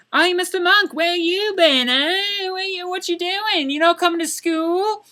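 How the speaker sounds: high-pitched mock teacher voice; nosy teacher. Teacher confronting student about a missed day, high and mocking. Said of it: "this teacher comes up to me".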